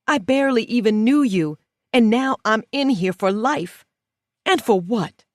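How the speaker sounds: outraged prisoner confronting her framer; angry. A narrator's reading. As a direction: demand an answer.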